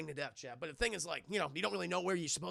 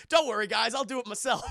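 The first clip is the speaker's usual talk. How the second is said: loud, laughing hero bluster; mock brave shout; 4 semitones higher, 9 dB louder than usual. Joking to the group as he heads off, loud cocky bluster. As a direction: play it as a cocky volunteer, half laughing. TJ Miller volunteers, mock bravado.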